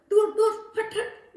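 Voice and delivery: high, loud shoo; old granny shrieking shoo; shooing yell. Acted. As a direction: chase the monkey off. Granny shoos monkey.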